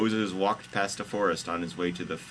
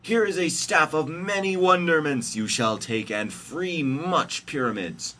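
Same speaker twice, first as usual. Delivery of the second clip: high, loud grand proclamation, easing toward the end; hammed-up storybook savior; Jesus character voice; 9 semitones higher, 5 dB louder than usual. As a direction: declaim it high like a comic prophet. Jesus hands over staff in story.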